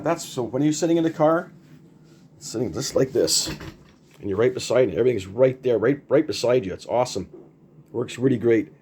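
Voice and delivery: ordinary talk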